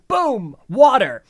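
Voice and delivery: loud, high, drawn-out boom; dramatic exclamation. Retold or imitated. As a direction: announce a sudden disaster with a bang. Sudden flood in the recap.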